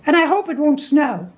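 very high, cheery piping; cheerful Rabbit. A narrator's reading. Rabbit consoling stuck Pooh, high and cheerful. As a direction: chirp it brightly in a very high voice. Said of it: "said Rabbit cheerfully".

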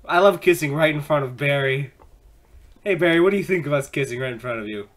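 usual speaking voice

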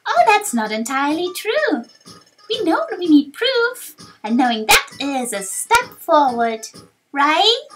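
high, loud, rallying youngster; upbeat young hero. Acted. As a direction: cheer the team on, ending high. Young hero encourages friends.

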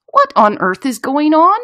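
high, loud, baffled mother; flabbergasted mum demanding an explanation; exasperated cry. A narrator's reading. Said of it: "my mum cried".

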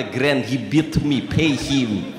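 put-on Teddy KGB Russian accent. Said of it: "to my grandmother".

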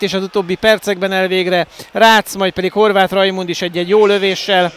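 loud, high, breathless goal recap; excited hungarian sports commentary. Real, not acted. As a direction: keep riding the goal high. Commentary right after the goal. (izgatott magyar sportkommentár)

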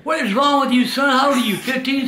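ordinary talk, nothing marked

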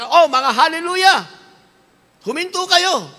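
a sneering scoffer calling out; scoffers' mocking call. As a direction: jeer at the believers.

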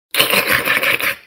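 voiceless, crackling rhythmic incantation; whispery clicking chant; magic spell. A narrator's reading. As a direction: rattle off the spell in crisp, toneless bursts. Fairies' magic spell in story.

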